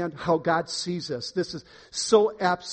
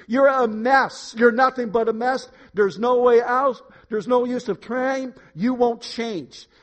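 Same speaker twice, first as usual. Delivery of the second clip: loud, high, hammering accusations; accusing devil; 6 semitones higher, 8 dB louder than usual. Acted. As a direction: play the devil condemning someone forcefully, higher and louder. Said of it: "Here's what the devil has been whispering".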